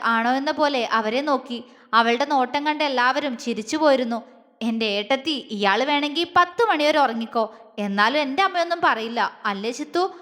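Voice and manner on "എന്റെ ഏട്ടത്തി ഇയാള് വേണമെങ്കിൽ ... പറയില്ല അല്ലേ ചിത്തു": ordinary delivery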